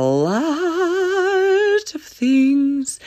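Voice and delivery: A LOT stretched long, rising high and loud; "a lot" blown up big and long; drawn-out emphasis. Real, not acted. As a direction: hold "lot" on a high, swelling note. Exaggerating how much was shared.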